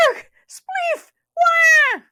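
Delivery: very high, loud panicked yelps; terrified rabbit cries. A narrator's reading. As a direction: shriek and scramble away. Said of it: "exclaimed Rabbit".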